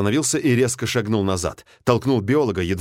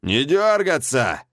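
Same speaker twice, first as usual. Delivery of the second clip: shouted command; 6 semitones higher, slower than usual. Acted. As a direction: bark it slowly like a warning. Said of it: "крикнул вертолётчик".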